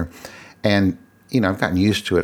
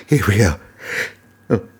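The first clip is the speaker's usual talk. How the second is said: sneezing. Real, not acted. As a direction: let the sneeze break through. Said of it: "I might sneeze again".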